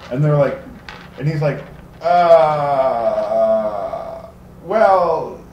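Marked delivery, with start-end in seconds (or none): 2.0-5.4 drawn-out, hammed-up hemming and hawing; nervous stalling. Timmit answering orcs who threaten to kill him, stalling. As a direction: stall for time with stretched filler words.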